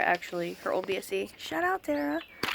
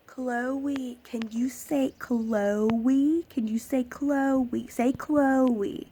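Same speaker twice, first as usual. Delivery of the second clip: louder, very slow exaggerated coaxing; mocking a stranger; 3 semitones higher, 5 dB louder, much slower than usual. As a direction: drag out each word like talking to a baby. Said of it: "get in her face and say".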